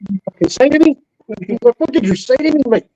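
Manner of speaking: sharp, high mock challenge; a Scotsman squaring up for a fight over an insult, imitated angry; angry comeback. Tense, indignant retort. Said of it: "He was, like, ready to fight".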